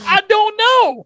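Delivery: loud, high, drawn-out bafflement; acted loud cluelessness; baffled exclamation. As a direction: throw it out high and slow, totally baffled. Retold baffled answer about runner.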